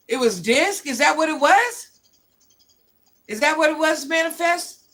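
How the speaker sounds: genuinely taken aback; surprised realization. Real, not acted. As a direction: rise up high as it dawns on you. Realizing what the news was.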